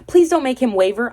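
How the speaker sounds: mock pleading. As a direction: imitate a girlfriend begging. Retold plea from the girlfriend.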